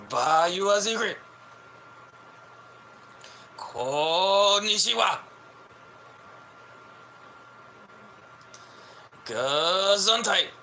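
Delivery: high, hammed-up fake Japanese; mock Japanese. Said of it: "You don't hear Americans talking like that".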